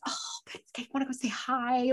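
tiny whispered whine; meek whispered plea. Retold or imitated. Fan to friend who won't go over, meek whisper. Small pleading to dismissive friend.

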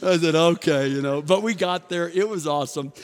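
loud, excited storyteller. Real, not acted. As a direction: ride the room's energy, big and loud. Excited after big laughter.

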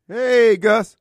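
loud greeting across the room; a loud hail to a friend arriving; calling out. Real, not acted. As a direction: call "Hey, Gus" out to him. Calling out to a guest.

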